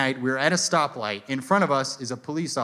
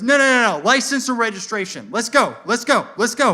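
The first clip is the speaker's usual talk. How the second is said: high, loud rapid-fire orders; barked, repeated cop commands; imitated impatient cop; 7 semitones higher, 5 dB louder than usual. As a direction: order them to hurry as an irritated cop. Acted cop demanding papers impatiently.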